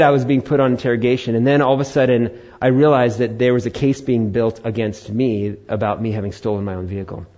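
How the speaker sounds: usual speaking voice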